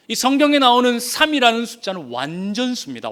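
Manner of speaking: normal talking voice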